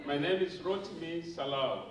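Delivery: usual speaking voice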